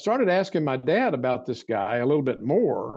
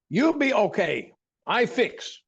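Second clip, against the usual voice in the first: higher, slow fond imitation of an old healer; silly voice; 7 semitones higher, slower than usual. Recalling the barefoot doctor at his bedside, in a higher silly voice. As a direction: lift the voice up and take it slowly. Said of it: "the silly voice I'm doing".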